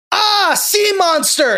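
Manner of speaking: high, slow imitated cry of alarm; mock alarm. Luca betraying Alberto as he cowers, mock terrified cry.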